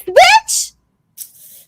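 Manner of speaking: very high, slow drawn-out disbelief; incredulous. Real, not acted. Mocking Parmita's timeline, high drawn-out disbelief. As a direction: stretch it out, outraged.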